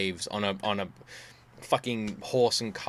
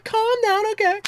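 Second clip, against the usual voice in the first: mimicking wife. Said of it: "She's like".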